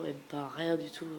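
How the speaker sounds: usual speaking voice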